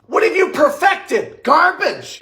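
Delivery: hammed-up fury at a failed recipe; mock outrage. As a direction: demand an answer from the salad, drawn out. Disgusted verdict on the salad.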